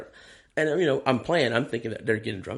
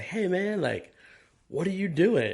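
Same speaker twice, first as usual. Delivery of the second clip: eager friend; 9 semitones higher, slower than usual. Retold or imitated. Kev calling, voiced high.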